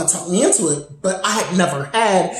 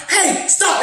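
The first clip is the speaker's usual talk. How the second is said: sharp, high retold yell; aggressive shout; 10 semitones higher than usual. Ex shouting at the intruder, aggressive shout. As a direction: snap it like a command. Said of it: "he got real aggressive".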